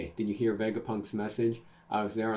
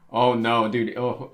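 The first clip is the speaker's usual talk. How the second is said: excited outburst; 2 semitones higher, 10 dB louder than usual. Real, not acted. Hyped reader reacting to reunion.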